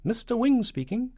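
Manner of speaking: high, loud little shopkeeper voice; Mister Wing. Acted. Shopkeeper answering the president's call, small high voice. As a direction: answer the phone in a piping voice. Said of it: "said a small, distant voice".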